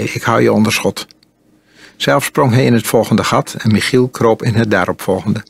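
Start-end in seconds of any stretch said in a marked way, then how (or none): none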